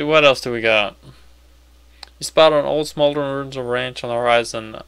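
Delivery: ordinary delivery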